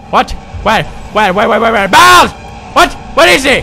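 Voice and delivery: high loud frantic babble; panicked squealing. Acted. Gamer hiding from a monster, high panicked babble.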